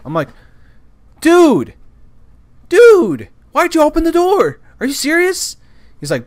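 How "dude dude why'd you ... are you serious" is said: loud, high-pitched exasperated yell; furious. Retold or imitated. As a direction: confront him in outraged disbelief. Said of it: "So I'm furious".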